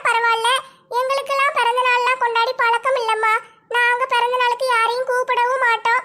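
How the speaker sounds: a tight-fisted neighbour making excuses, pitched high; stingy neighbour Radha. Acted. (கஞ்சத்தனமான பக்கத்து வீட்டுப் பெண்ணின் உயர்ந்த குரல்)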